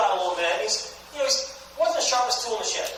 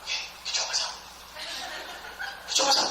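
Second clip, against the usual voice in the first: self-calming mutter; 6 dB quieter, faster than usual. Retold or imitated. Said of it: "Just remember".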